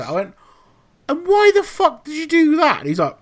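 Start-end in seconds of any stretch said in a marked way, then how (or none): 1.1-2.8 higher, louder, incredulous mock anger; retold outburst. Chef rounding on Gav over the toaster, mock-angry and high. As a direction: fire the question back in disbelief.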